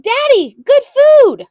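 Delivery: high, loud, slow and pleading; child voice begging her father; eager child plea. A narrator's reading. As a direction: coax Daddy with delight.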